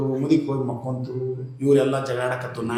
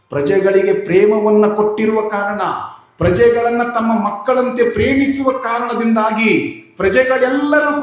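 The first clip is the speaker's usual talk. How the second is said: raised, loud sermon voice; preaching; 8 semitones higher, 8 dB louder than usual. Acted. Preacher telling listeners the poison was in their hearts, loud and high. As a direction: hammer the moral home to the crowd.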